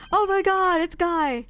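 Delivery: loud, high excited yell; excited outburst. Real, not acted. Streamer spotting a favourite character, excited yell. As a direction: burst out at the sight of him.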